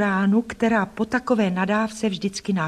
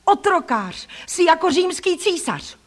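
high, defiant accusations; raised voice, outraged defiance; angry crying out; 9 semitones higher, slower than usual. A narrator's reading. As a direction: hurl insults at the bully. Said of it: "vykřikla jsem".

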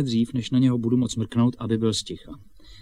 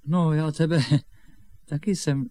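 ordinary talk, nothing marked

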